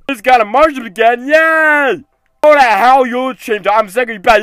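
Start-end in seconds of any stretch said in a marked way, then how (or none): none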